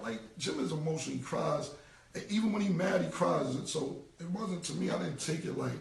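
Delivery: usual speaking voice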